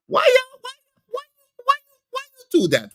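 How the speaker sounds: very high falsetto whine; mock hurt. Streamer teased about his hairline, wounded falsetto. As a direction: break into squeaky stammered protest.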